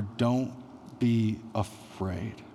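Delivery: slow, soft, reassuring hush; tender reassurance. A narrator's reading. God breaks four hundred years of silence, gently. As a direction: comfort them slowly. God's first words after silence.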